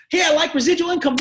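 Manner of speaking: a prospect gushing; eager prospect. Acted. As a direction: burst it out like a fan. Acting a keen prospect.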